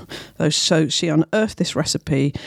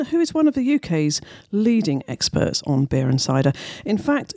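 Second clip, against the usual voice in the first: ordinary delivery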